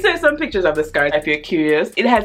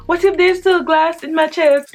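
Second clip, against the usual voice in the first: high urgent; 11 semitones higher, 4 dB louder than usual. Retold or imitated. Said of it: "I'm like".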